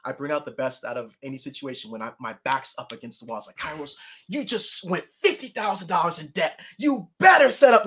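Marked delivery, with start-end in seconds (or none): 3.6-7.8 slow, then high, loud warning; pressuring himself. Pretend. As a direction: bark a deadline at yourself.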